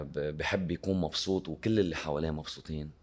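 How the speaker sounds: normal talking voice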